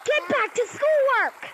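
high, drawn-out scolding; exasperated parent's stretched-out command; scolding order. Acted. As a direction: yell it slowly up high at the kids. Parent orders kids back to work.

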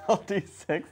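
laughing as the words come out; amused. Real, not acted. Player laughing at own dice slip, amused.

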